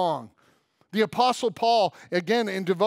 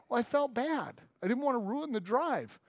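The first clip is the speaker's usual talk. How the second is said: quiet, sheepish excuse from the uncle; softer, apologetic uncle voice; 8 dB quieter than usual. Retold or imitated. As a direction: mumble it like a guilty uncle. Uncle excusing himself to kids.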